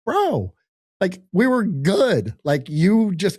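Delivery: a pleading, disbelieving "we were good"; high-pitched let-down reproach; exasperated bro. Retold or imitated. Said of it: "I'm literally saying it like this".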